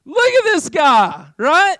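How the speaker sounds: loud, high, drawn-out scorn; mock indignation. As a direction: call everyone's attention to the worse offender. Acting out judging another person's baggage.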